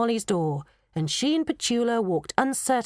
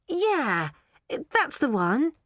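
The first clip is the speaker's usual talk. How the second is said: happy; 7 semitones higher, much slower than usual. Acted. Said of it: "Molly said happily".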